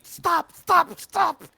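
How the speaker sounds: high, loud frantic yelps; shrill-pitched cries of acted terror; panicked pleading. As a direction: beg desperately for it to stop. Captive begging as arm is pulled.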